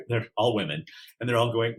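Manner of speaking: ordinary talk, nothing marked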